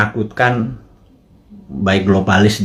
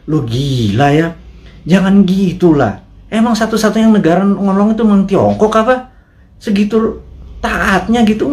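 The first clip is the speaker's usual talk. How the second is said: mocking a rude official; 8 semitones higher than usual. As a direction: sneer it like a rude official brushing off a question. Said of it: "Itu kalimat yang benar-benar menurut saya". (tiruan pejabat yang kasar, nada tinggi dan lambat)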